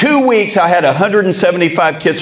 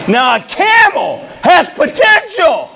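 rehearsal shout; 10 semitones higher than usual. Retold or imitated. To the parents, bellowing the big reveal. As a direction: proclaim it to the auditorium.

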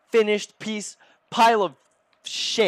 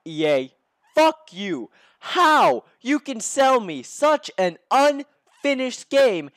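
angry rant; 6 semitones higher, 3 dB louder than usual. Real, not acted. Ranting at EA over frozen save.

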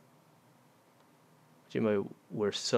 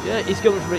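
panicked; 8 semitones higher, 10 dB louder, faster than usual. Real, not acted. Panicked chase.

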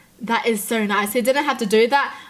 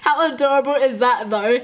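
ordinary talk, nothing marked

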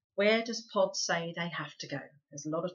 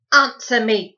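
put-on sharp, much louder command to the boy; loud demand; 4 semitones higher, 16 dB louder than usual. A narrator's reading. Housekeeper barking at the caught boy, loud. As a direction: bark it at him. Mrs Driver demands answer.

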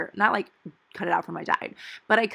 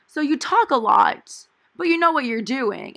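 mock professor; 6 semitones higher, 7 dB louder than usual. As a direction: play the teacher's backhanded praise. Professor's backhanded remark quoted.

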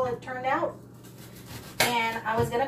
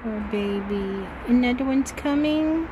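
soothing cat talk; 7 semitones higher than usual. Real, not acted. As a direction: coo gently to the laboring cat. Soothing a cat giving birth.

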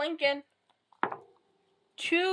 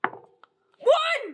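high yelp of the number; excited shout; 12 semitones higher, 4 dB louder than usual. Real, not acted. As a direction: cry out the lucky roll. Rolled the winning number.

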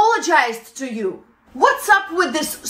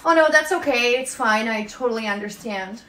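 understanding employee, sinking softer and lower; polite acceptance; 6 semitones lower, 4 dB quieter, faster than usual. Acted. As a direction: take the bad news meekly, trailing down. Acted polite laid-off employee replying.

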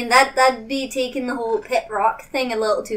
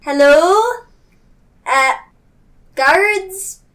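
calling out hello to distant figures; raised call into the distance; 8 semitones higher, 6 dB louder, slower than usual. Pretend. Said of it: "like, yelling from a little distance away".

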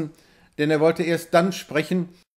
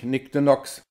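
ordinary delivery, nothing marked

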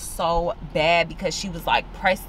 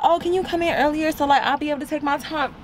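higher, sweetly mock-polite request; mock the massage lady's overly nice ask, higher; mimicking massage lady; 10 semitones higher, faster than usual. Telling viewers how the massage lady asked, higher mock-sweet imitation. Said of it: "she's like".

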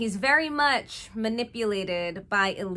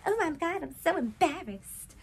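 put-on higher flustered girlish voice; mock embarrassed Kate; 7 semitones higher than usual. Kate embarrassed before Daniel, lightly acted. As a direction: play it flustered and high. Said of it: "And she's like".

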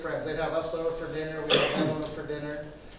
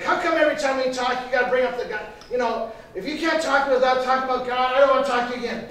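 loud, higher angry rant, imitated; mad; 8 semitones higher, 9 dB louder than usual. Friend fed up with gospel talk on phone, angry outburst. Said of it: "this time he got mad".

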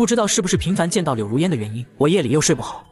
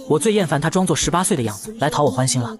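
plain reading voice

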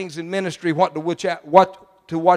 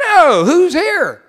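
surprised question; 8 semitones higher, 6 dB louder, much slower than usual. Retold or imitated. Wife answers, puzzled.

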